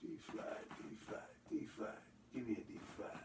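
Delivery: hushed mutter while rummaging; whispering to himself. Real, not acted. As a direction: whisper it under your breath, searching. Searching for a harmonica, muttering.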